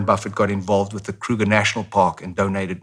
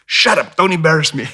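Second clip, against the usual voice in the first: higher, louder sharp telling-off; scolding mom voice; 7 semitones higher, 5 dB louder than usual. Retold or imitated. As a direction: bark it at the child. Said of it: "And in South Africa".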